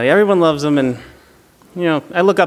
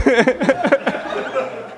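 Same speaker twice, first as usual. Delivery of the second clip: breathy burst of laughter; just crack up; 6 semitones higher than usual. Real, not acted. Laughs at own story.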